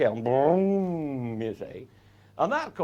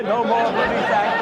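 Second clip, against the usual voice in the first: normal talking voice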